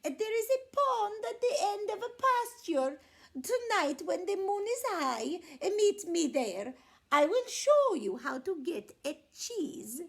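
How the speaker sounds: scheming little lamb; high sly lamb laying a trap. A narrator's reading. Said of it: "Borreguita said".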